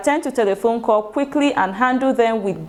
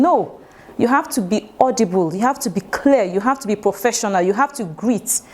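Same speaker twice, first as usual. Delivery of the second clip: ordinary talk, nothing marked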